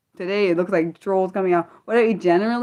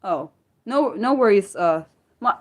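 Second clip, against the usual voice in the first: ordinary talk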